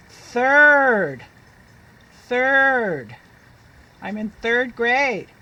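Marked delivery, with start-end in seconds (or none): 0.1-3.1 put-on "bird", "Girl", "dirt" stretched and loud; loud phonics drill. Shown as a demonstration. Teacher leading children in saying words aloud, loud. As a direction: sound out each word big for the class. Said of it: "Say it with me".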